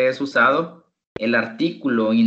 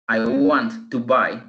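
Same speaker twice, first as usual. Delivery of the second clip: slow model phrase; slower than usual. Shown as a demonstration. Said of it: "teacher slows phrase".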